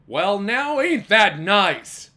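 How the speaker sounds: put-on drawn out like an old street sweeper. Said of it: "the man who swept the street said".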